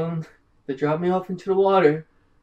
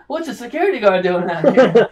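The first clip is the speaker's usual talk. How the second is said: loud, high, laughing exclamation; mock alarm; 7 semitones higher, 8 dB louder than usual. Players joke about finding a security guard, mock alarm. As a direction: play startled discovery with a laugh.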